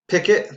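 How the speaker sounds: picket called out high and loud; model word said out. Shown as a demonstration. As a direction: say picket loud and clear for the class. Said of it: "you're going to repeat the word after I say it".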